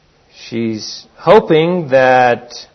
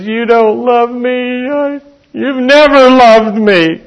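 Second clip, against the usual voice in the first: a much higher, louder, slow wounded accusation; hurt wife; 10 semitones higher, 6 dB louder, much slower than usual. Retold or imitated. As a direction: plead your hurt, drawing out each word.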